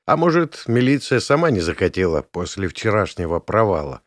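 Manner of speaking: plain reading voice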